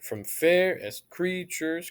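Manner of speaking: slow "from fairest creatures", stress on FAIR and CREA; scanned reading. Shown as a demonstration. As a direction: tap out the iambs slowly. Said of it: "'cause we say creatures".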